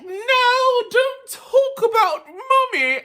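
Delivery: put-on very high, loud English-accented whine; slow, shrieky mama's boy with an English accent; mock English accent. Ed defends his mother to Henry, mock English accent. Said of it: "why he got an English accent".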